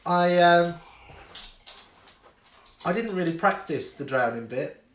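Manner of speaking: ordinary talk, nothing marked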